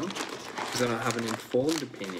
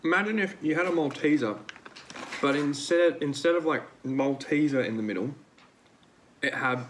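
talking while chewing chocolate; slightly muffled chewy speech; mouth full, chewing; 3 semitones higher than usual. Real, not acted. Seen 2: Bites at -9s, pursed chewing mouth, hand to mouth 6.2s.

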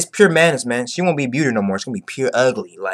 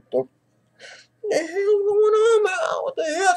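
pained cry; 5 dB quieter than usual. Retold or imitated. Law stabbed and crying out in pain, high wail. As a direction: wail it out, wounded.

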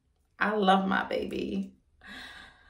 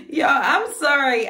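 laughing; 8 semitones higher, 5 dB louder, slower than usual. Real, not acted. Laughing after the kick.

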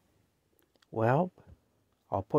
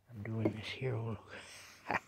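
whisper; 12 dB quieter, slower than usual. Real, not acted. Hushed aside while feeding cat.

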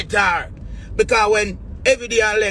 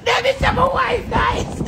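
laughing shout. Retold or imitated. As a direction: yell her name while cracking up. Shouting to Debbie through laughter.

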